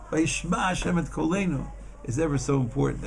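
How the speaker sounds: ordinary talk, nothing marked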